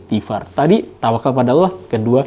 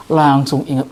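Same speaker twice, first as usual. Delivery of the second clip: ordinary talk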